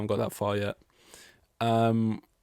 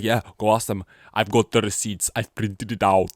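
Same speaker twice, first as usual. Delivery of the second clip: louder, mock-triumphant challenge; defiant taunt; 6 dB louder than usual. Toto daring Horner to ask his drivers, loud defiant taunt. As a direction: wave the evidence in his face. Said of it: "He's like".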